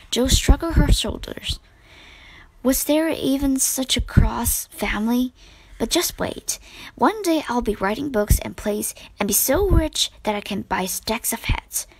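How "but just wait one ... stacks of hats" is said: ordinary delivery, nothing marked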